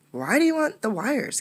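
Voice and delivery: high, puzzled friend's question; curious friend. Acted. Gift puzzled, asking Condi about the wires. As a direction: ask it like a curious boy.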